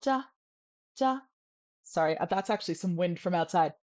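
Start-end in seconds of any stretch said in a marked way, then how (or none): 0.0-1.2 "Ja" between ch and J, no breath; unaspirated high-tone "Ja"; soft CH. Shown as a demonstration. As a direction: say "Ja" without any puff of air. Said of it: "closer to a J".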